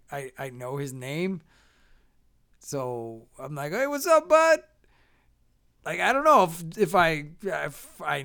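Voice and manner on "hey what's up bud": high greeting, ending loud; feigned familiarity. Retold or imitated. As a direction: hail a buddy you can't place. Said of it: "instead of, like, being surprised".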